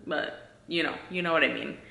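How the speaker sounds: normal talking voice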